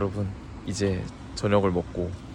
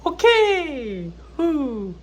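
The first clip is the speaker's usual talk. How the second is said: excited shout; 9 dB louder, much slower than usual. Real, not acted. Energetic comeback after nap.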